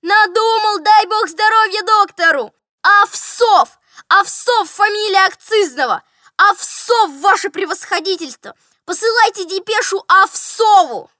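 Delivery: high, loud triumphant cries; joyful shouting. A narrator's reading. Steward announcing the remembered surname to general, shouting joyfully. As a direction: shout the discovery jubilantly. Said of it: "закричал он радостно".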